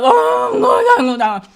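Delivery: high, loud mock wail; imitated crying. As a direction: wail it like you're crying in labor. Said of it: "I was, like, crying".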